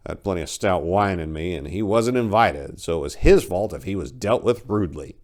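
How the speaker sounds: ordinary delivery, nothing marked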